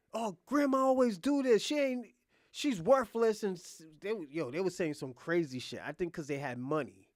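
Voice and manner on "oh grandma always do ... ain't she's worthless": high, louder scornful daughter; mock dismissive whine. Daughter dismissing her grandma, high scornful mock. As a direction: sneer at grandma, pitched up.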